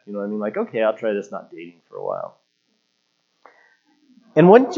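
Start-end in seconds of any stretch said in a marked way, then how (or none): 0.6-2.4 low, quiet, resentful agreement; retold sulky concession under the voice; grudging mock anger. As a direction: give in grudgingly, lower and softer. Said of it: "I was so mad".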